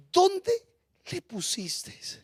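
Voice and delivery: first word lifted, then slow and very soft; hushed, moved question. Acted. Preacher repeats Jesus's question so the congregation listens, hushed and moved. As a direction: let the question sink almost to nothing.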